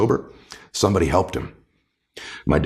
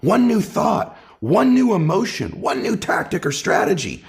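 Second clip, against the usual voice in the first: rising urgency; 8 semitones higher than usual. Real, not acted. Guest building his list to Jay, rising and urgent. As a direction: pitch climbs higher with each one, slowed and stretched.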